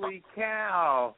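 slow, high amazed exclamation; surprised. Real, not acted. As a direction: marvel at the news. Stunned at guest coming.

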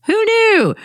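sarcastic high who knew. Real, not acted. Sarcastic remark about being watched.

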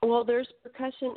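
normal talking voice

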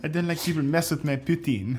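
笑いながら下手なフランス訛り; mock French-accented line, laughing through it; mock French accent, man's voice. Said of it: "I can only do it in a man's voice".

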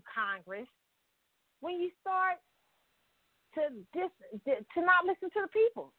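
ordinary talk

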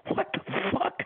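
breathless, high shocked gasp of words; stunned at the smashed lamp, airy and high. Retold or imitated. Witness reacts to a lamp smashed over a head, breathless shock.

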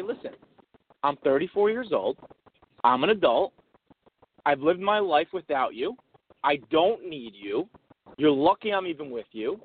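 measured and low, pausing after each sentence; stern declaration. Pretend. Pretend husband standing up to his wife, stern. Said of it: "You say".

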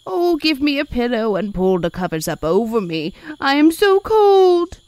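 put-on high, pleading old-woman voice, building to a wail; crying elderly woman. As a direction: plead loudly to the nurses, drawing out the end. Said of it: "She cries to the nurses".

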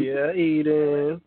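drawn-out playful voice. Pretend. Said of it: "echoing hype in another voice".

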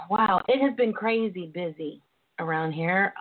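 usual speaking voice

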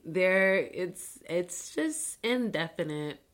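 usual speaking voice

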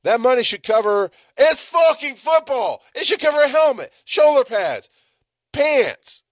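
loud, high, heated rant; angry rant. Real, not acted. Podcaster ranting about football fees, loud and heated. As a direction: rail at the football program.

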